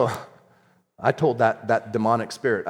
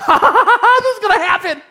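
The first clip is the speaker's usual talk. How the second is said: high-pitched giddy cackle running into words; acting out a giddy outburst; giddy laughter; 8 dB louder than usual. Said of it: "I got a little giddy".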